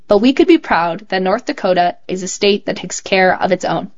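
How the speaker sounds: usual speaking voice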